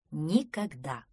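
firm, understated assurance. A narrator's reading. Кавриксон assures Пронырсон about the sea, mildly firm. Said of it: "твёрдо ответил Кавриксон".

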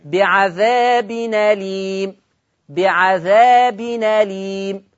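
drawn out slowly, syllable by syllable; slow recitation. Shown as a demonstration. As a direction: recite it slowly for them to copy. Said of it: "سامعين رددتها كم مرة؟". (تلاوة بطيئة متأنية)

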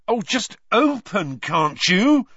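loud, high, drawn-out exasperation; angry. A narrator's reading. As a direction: demand it in a frustrated outburst. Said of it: "he said angrily".

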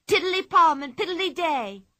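high, loud teasing chant; playful sing-song. Acted. Pippi taunting the charging strongman, sing-song. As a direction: tease him as he charges.

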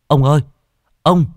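loud, high, panicked call; a boy crying out in distress as he bursts in; breathless lad calling for the old man; whimpering panic. Acted. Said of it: "mếu máo".

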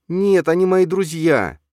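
put-on high, loud, slow boy's protest; boy defending friends to mother; child voice. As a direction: defend them like a small boy. Boy defends his old friends.

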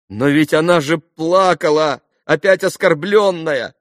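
high, loud, drawn-out protest; crying out. A narrator's reading. Alyosha protests that she was crying, a loud outcry. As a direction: cry out in distressed objection. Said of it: "вскричал Алёша".

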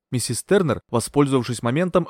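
ordinary delivery, nothing marked

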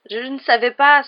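high, loud tearful protest; sanglotant. A narrator's reading. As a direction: wail it as a distressed girl, acted. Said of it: "sanglota Ginny". (protestation en sanglots, aiguë et forte)

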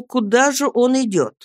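ordinary delivery

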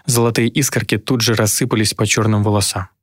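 ordinary delivery

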